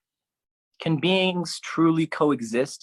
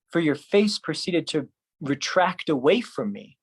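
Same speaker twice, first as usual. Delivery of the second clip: ordinary delivery, nothing marked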